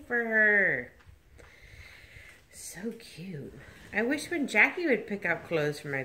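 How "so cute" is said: whisper. Real, not acted. Whispering over the baby.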